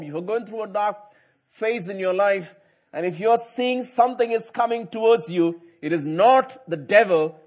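normal talking voice

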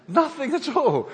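laughing it out high and loud. Real, not acted. Preacher laughing at the swordless friend, loud high repeat. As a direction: throw the punchline out with a laugh.

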